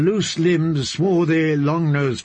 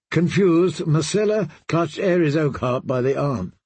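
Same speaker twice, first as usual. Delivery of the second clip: ordinary delivery, nothing marked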